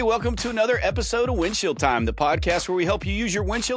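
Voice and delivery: upbeat, high-pitched show opening slowing toward the end; host announcing the episode; podcast intro. Acted. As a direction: open the show with a big welcome, then ease down.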